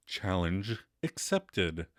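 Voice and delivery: slow, low announcer voice; lightly voiced game show host. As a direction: announce it slowly to the audience. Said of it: "announces for the viewing audience".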